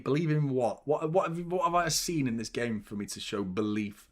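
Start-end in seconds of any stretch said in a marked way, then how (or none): none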